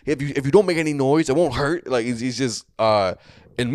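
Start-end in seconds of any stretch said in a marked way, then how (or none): 0.1-1.9 high, loud imitation of an angry scolding; getting mad. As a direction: scold them as an irate leader, acted out. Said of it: "he starts getting mad".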